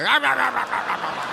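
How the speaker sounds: frantic "rah rah rah" gobbling noises; mock ravenous gobbling. Man taking some home in a doggy bag, frantic mock gobbling. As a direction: gobble it up like a starving dog. Acts out men eagerly eating.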